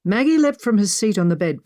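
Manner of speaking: usual speaking voice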